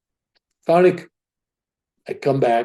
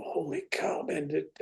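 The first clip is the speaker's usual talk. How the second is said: higher, soft whisper of astonishment; awed whisper; 4 semitones higher, 13 dB quieter, slower than usual. Retold or imitated. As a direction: gasp it out in amazement. Stunned by guru's words.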